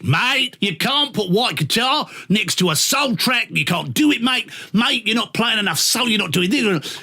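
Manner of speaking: a hint of an Australian boss laying down the law. Imitating an Australian boss on the playlist, lightly Australian. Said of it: "we had two Australian bosses".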